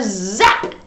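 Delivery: plain reading voice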